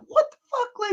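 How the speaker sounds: very high squeal of disbelief; shocked kid. Retold or imitated. Reviewer recalling his childhood shock, very high. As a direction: blurt it out stunned. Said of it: "just being like".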